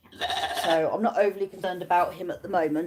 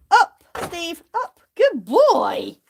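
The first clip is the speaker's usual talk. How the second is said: high, louder command then praise; 9 semitones higher, 6 dB louder, much slower than usual. Real, not acted. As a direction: train him. Training command.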